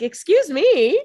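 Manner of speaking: slow, high, loud mock offence; indignant. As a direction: drag it out, affronted and loud. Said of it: "Elena's like".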